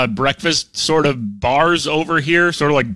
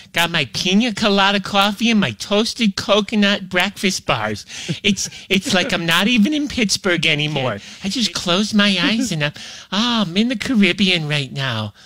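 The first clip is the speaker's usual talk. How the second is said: mock vacation bliss; 5 semitones higher than usual. Pretends Caribbean vacation over coffee.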